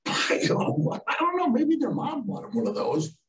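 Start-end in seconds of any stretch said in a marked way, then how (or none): 1.1-3.2 very high, sheepish excuse; softly squeaked, retold excuse; feigned innocence. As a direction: play dumb in a high voice.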